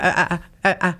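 "Ah" repeated as a stuttering block; imitated stutter. Retelling her stuttering date in the car, "Ah" caught in a mild stutter. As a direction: gently mimic someone stuck stuttering on "Ah". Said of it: "je vais pas imiter méchamment, mais dans le sens que ça ressemble à ça".

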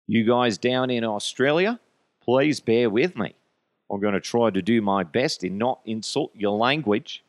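put-on, clumsy Australian accent; bad Australian accent. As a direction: attempt an Australian accent, badly. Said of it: "I apologize if my accent sucks".

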